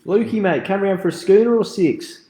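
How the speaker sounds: high, loud hammed-up Australian accent; mock Aussie mate. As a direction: play a matey Aussie inviting a mate for a beer. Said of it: "great Australian gags".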